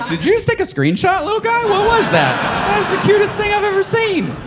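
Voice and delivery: put-on high cooing to a baby; baby talk. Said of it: "I was like".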